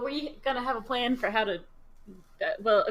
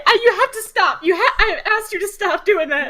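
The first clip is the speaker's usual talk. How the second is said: higher and louder, begging him to stop; frantic pleading; 6 semitones higher, 8 dB louder than usual. Acted. Nyla scolding Sal for waking her, loud pleading. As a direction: plead in a panic. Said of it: "Nyla runs over and she's like".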